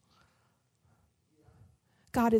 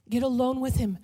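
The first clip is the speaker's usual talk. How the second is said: normal talking voice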